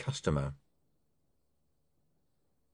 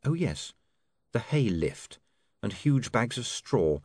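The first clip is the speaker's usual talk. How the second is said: plain reading voice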